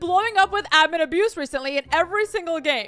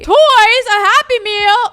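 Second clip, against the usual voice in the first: surprised; 5 semitones higher, 8 dB louder, slower than usual. Real, not acted. Surprised at game menu.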